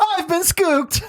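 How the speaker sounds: high, long drawn-out comic wail; stretched, high-pitched mock outrage; mock astonished cry. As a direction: ham up being the victim of a prank. Acting out the pranked car owner.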